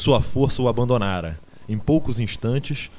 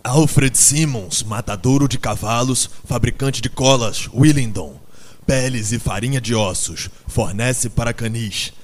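loud, deliberate reading of a sign; reading aloud; 6 dB louder than usual. A narrator's reading. Benjamin reads the van's lettering to silent animals, loudly. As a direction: read out every item forcefully. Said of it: "leu em meio a grande silêncio".

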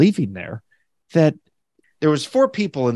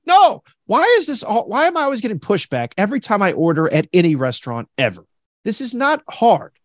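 exasperated rant; 6 semitones higher than usual. Retold or imitated. Customer fed up with pushback, high exasperated rant.

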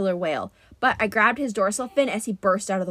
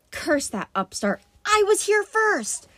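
grumbled curse rising to a high outburst; indignant grumble; 10 semitones higher than usual. Acted. Beaten sea god rages at rival.

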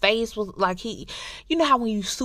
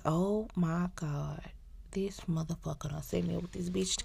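shocked whisper; 2 semitones lower, 12 dB quieter than usual. Retold or imitated. Recapping a show, voicing the betrayed brother in a stunned whisper. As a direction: breathe it out like a betrayed man. Retelling Kid's shocked realization.

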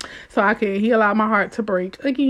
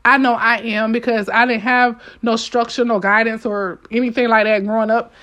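ordinary talk, nothing marked

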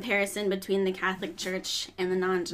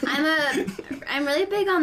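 high and louder, laughing; 8 semitones higher, 6 dB louder than usual. Real, not acted. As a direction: laugh through the words. Laughing through answer.

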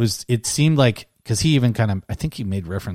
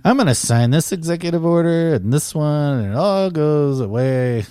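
higher, louder, slowing lightly voiced Biden; mock Biden; 4 semitones higher, 7 dB louder than usual. Podcaster mocking the president's orders, a light higher, louder impression. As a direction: play a rambling old president signing orders.

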